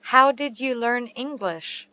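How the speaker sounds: usual speaking voice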